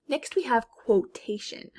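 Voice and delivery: ordinary delivery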